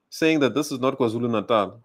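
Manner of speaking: usual speaking voice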